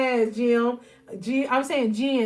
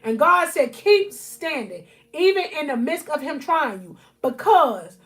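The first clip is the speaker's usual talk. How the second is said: louder, higher, building sermon cadence; full-force preaching; 4 semitones higher, 4 dB louder than usual. Acted. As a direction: preach it hard to the congregation.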